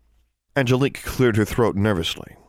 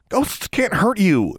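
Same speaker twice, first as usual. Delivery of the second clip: high, exasperated snap; snapping; 12 semitones higher, slower than usual. A narrator's reading. Girl frustrated with a frightened boy, snapping. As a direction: snap it, stretching the words in irritation. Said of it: "she snapped".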